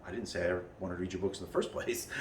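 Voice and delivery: inner thought. Retold or imitated. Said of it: "I thought to myself".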